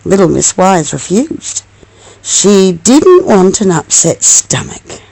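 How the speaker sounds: usual speaking voice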